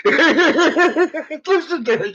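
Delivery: real laugh over the words. Real, not acted. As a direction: squeeze it out while cracking up. Laughing at co-host.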